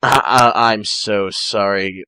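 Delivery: ordinary delivery, nothing marked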